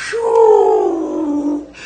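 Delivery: a high breathy whew of release; relieved whew. Retold or imitated. Birds flying off, relief.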